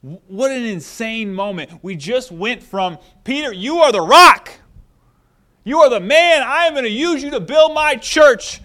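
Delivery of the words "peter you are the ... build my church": excited praise. Retold or imitated. Retold praise of Peter.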